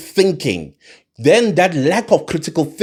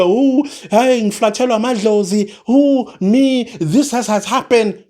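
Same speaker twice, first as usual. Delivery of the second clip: higher, hammed-up wail of regret; mock lament; 7 semitones higher than usual. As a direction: mimic someone crying out over a misfortune. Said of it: "then you're gonna come and say".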